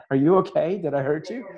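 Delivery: high, anxious checking on someone; retold alarm. Healer who thought he killed him, alarmed retelling.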